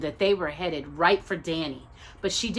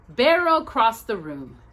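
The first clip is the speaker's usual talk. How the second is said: ordinary delivery, nothing marked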